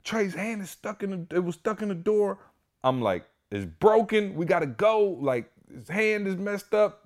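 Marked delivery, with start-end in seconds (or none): 0.0-2.4 very high frantic reenactment; acted-out panic in a strained high pitch; panicked. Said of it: "yo, I'm so panicked". 3.5-7.0 high, loud-edged frantic retelling; reenacted alarm, pitched way up; panicked. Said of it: "yo, I'm so panicked".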